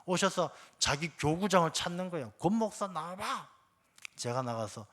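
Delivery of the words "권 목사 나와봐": higher, mild mock holler; retold drunken calling. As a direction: imitate a drunk demanding someone come out. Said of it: "소리 질러요".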